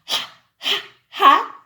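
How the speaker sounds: breathy ha, ha, ha, the last one loud; h sound. Shown as a demonstration. Teacher demonstrating the H sound, breathy ha. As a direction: push air out on each Ha, the third ha punched loud. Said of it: "the letter H that makes a sound".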